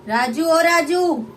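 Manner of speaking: high, loud, slow call; mother calling her son. Acted. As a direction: call Raju from afar.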